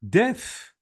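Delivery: "Diff" cut off as its own beat; first syllable. Shown as a demonstration. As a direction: isolate "Diff" before a pause. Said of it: "is the first syllable".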